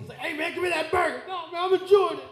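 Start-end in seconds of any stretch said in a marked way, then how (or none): 0.2-1.2 mock tough inmate. Retold prison food grab. 1.3-2.2 high shouted mock reply.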